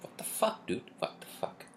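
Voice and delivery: unvoiced, hushed what the fuck; whispered disbelief. Retelling his reaction to being hit, whispered disbelief. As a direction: breathe it out in disbelief. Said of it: "I'm like".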